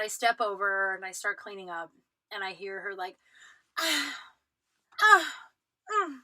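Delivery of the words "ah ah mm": mock pouting whines rising high; hammed-up pouty groans; imitated pouting sighs. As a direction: whine and sigh like a sulking child. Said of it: "like pouting".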